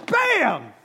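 sudden loud high bam. Retold or imitated. Retelling the bird hitting the window, sudden loud bam. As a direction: slam the word out like the impact.

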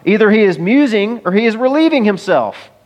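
lightly mocking taunt. A narrator's reading. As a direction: read it like Elijah taunting them. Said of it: "Elijah mocked them".

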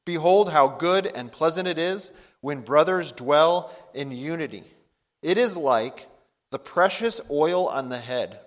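slow, emphatic psalm reading; measured Bible reading with strong stresses; scripture reading. Acted. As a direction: proclaim the verses to a congregation, unhurried. Reads the psalm aloud to congregation.

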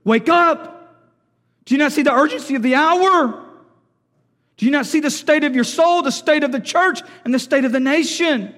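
loud, insistent preacher's plea; urgent wake-up call. Acted. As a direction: cry out a pressing warning. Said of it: "is God telling us".

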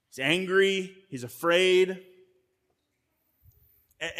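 ordinary delivery

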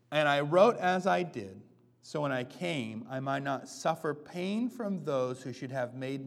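slow, loud, measured reading of the verse; slow scripture reading. Acted. Pastor reading Paul's letter to the congregation, slow and loud. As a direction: lay each phrase out slowly for the room.

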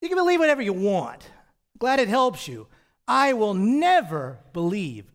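acted-out defiant unbeliever; dismissive skeptic. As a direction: imitate a stubborn younger self, loud on the refusal. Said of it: "That's exactly the kind of thing I would say".

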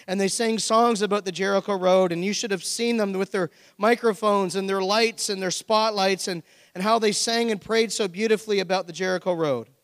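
loud, emphatic sermon story; preaching storytelling. Acted. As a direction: tell the parable at full preaching force. Reads parable with preaching force.

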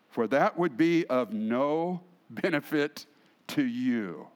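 slow, emphatic reading with long pauses. Shown as a demonstration. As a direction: stretch out the key words of the verse. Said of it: "let's read it again slowly".

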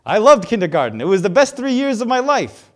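loud, eager comic monster doing bad stand-up; Mike Wazowski. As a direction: ham it up, desperate to get a laugh. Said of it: "It's Billy Crystal, the voice of this monster".

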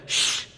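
soft, sharp shush; "shh" to quiet someone; hushing. Acted. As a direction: hush a noisy man in a crowd. Annoyed crowd hushes him.

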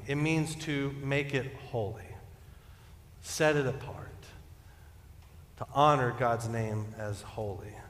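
ordinary talk

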